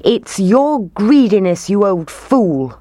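angry shout. A narrator's reading. Townspeople angry with the mayor, accusing shout. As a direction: lay the blame squarely on him. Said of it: "they shouted".

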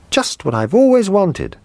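put-on high, delighted child's cry; excited cry. A narrator's reading. Little boy seeing his present, high delighted cry. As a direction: squeal with joy at the present. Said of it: "he cried".

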